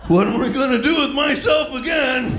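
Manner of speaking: high sarcastic dismay; hammed-up fake panic; mock despair.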